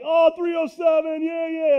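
high, loud excited greeting; imitating an excited local cheering the area code; pumped-up fan. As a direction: greet a fellow Wyoming native with glee. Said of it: "they're pumped".

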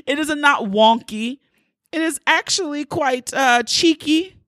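high, hammed-up British accent; slowing into a playful British accent; mock British accent. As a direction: play up a badly faked British accent. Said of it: "I'm gonna do this as much as I'm talking".

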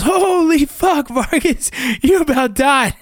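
imitating a shocked buddy, very high pitch; alarmed exclamation. Ranger buddy who just rolled him out of mud, alarmed.

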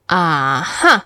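ah-huh starting low, lifting on huh; "ah-huh" with a low first part; low ah, rising huh. Shown as a demonstration. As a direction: hold "ah" low, then pop "huh" up. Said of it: "It's very low in your voice".

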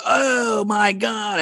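high, slow, disgusted cry; disgusted shout. Retold or imitated. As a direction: recoil in disgust, drawn out. Kids disgusted by fart.

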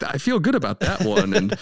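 high squeaky voice; high mock voice. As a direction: play a silly floating face. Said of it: "floating faces of celebrities".

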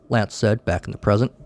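plain reading voice

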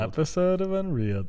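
announcer voice. Pretend. Announcing the show title.

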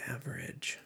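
whispered aside. Real, not acted. To himself while checking a fact, a whisper. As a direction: breathe the word to yourself.

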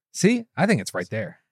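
usual speaking voice

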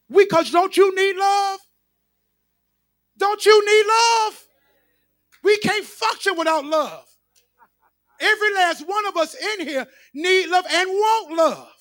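high, loud pulpit cry; building sermon fervour; preaching at full force. Acted. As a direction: lift the congregation with it.

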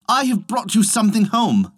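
high-pitched eager announcement; calling out. A narrator's reading. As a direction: call your news to your wife. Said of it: "he called".

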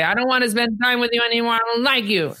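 put-on high, whiny kid voice; mock child. Host imitating a child rejecting a parent, high and whiny. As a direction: play the sulky child pushing dad away.

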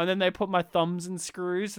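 ordinary delivery

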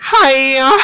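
high sing-song hiya; silly high call. Retold or imitated. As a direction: chirp it back like a cartoon. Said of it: "five people going back".

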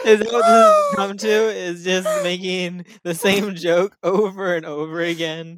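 loud, laughing disbelief. Real, not acted. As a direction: say it still laughing.

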